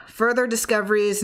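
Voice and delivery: usual speaking voice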